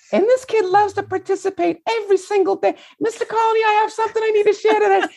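piping falsetto, eager schoolboy; very high, squeaky child impression; high-pitched kid voice. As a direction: imitate a keen little pupil in falsetto. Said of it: "He talks like this".

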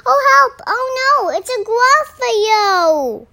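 very high, loud cry for help; alarmed cry. Acted. Mouse meeting the Gruffalo, loud acted panic. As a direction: shout it in storybook panic.

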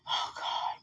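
breathy whispered oh God. Real, not acted. Overwhelmed while praying aloud, a soft whisper. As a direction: let it out as a bare whisper. Whispered exclamation in emotional prayer.

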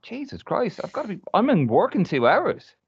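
very high, alarmed outburst; retold panic. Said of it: "I was like".

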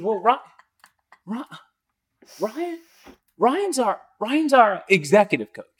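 grasping for the word, then proudly announcing; stammering excited. Retold or imitated. The VP introducing Ryan to another VP, stammering excitedly. VP stumbling to name role.

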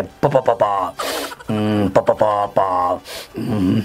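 mock a stutterer struggling to get the words out; hammed-up low stutter; stuttering driver. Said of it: "el que está manejando le dice".

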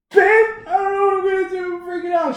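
panicked wail to babe. Retold or imitated. Telling girlfriend he's freaking out, high loud mock wail. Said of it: "She's like, Just calm down".

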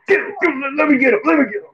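high, pleading cries to be let go; frantic fighter. Retold or imitated. Imitating Isaiah Stewart held back in the fight, high and frantic. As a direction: strain forward like you're being held back. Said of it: "he'll be like".

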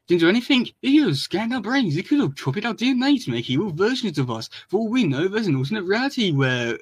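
high-pitched, incredulous rant; alarmed scolding. Acted. Donatello berates Mikey over the dangerous device, alarmed scolding. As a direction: throw the words back high, in rising alarm. Acted panicked scolding of brother.

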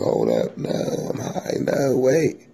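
pleading, ducking the blessing. Retold or imitated. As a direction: beg mom to wait while you sober up.

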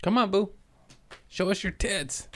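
high, playful pet-calling voice; mock coaxing. Real, not acted. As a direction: coax Boo in a silly high voice. Joins in coaxing Boo.

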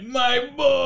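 high, drawn-out mock wail; grieving father. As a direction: wail it like a bereft dad. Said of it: "where he's like".

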